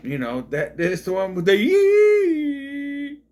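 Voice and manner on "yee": loud, long, high-pitched "yee"; high drawn-out squeal. Retold or imitated. Film reviewer mimicking the movie's noise, high squeal. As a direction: let out a long piercing yee. Imitates the film's sound.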